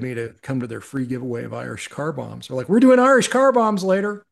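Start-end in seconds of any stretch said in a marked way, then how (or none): 2.7-4.2 higher and louder, an eager party-camp neighbor calling over; mock-enthusiastic party shout; excited invite. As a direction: sell the party like a cheerful host. Neighbors inviting him, retold.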